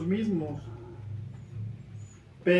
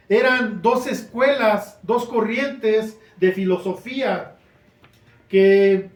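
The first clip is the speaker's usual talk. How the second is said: usual speaking voice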